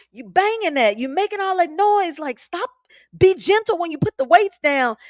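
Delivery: high, exasperated scolding, pitched well up; scolding husband. Retold or imitated. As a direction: snap at him to put the weights down gently. Scolding husband over dropped weights.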